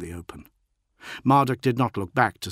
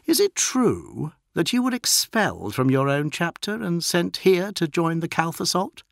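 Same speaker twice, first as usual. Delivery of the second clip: put-on raised, prying young marine; young warrior voice; 8 semitones higher than usual. A narrator's reading. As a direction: probe him, pitched up. Berias voiced by narrator.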